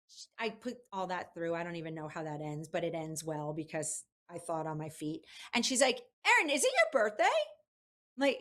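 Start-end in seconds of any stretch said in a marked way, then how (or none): 6.3-7.2 Barbara voice. Retold or imitated. Barbara Walters voiced in story.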